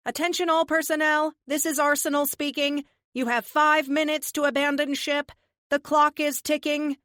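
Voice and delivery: loud, high tannoy address; PA announcement. Acted. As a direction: announce it over the station speakers. PA announcement to the crew.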